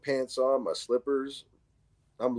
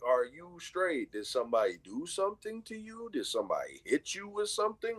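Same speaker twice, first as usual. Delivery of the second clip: higher, softer probing questions; careful concern; 5 semitones higher, 6 dB quieter than usual. Retold or imitated. Neighbor asks kid if someone hurt him, careful. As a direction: retell a gentle, puzzled check-in.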